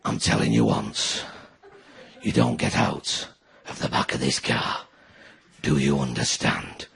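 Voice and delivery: very low, slow, quiet gravel threat; dad threatening the kids in the back of the car; Lee Van Cleef menace. Retold or imitated. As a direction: growl it like a spaghetti western villain. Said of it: "You just turned into Lee Van Cleef".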